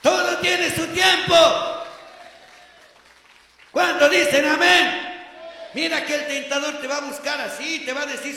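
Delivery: loud, ringing sermon voice; preacher booming to the congregation; full-force preaching. Acted. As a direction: preach it out to the whole hall.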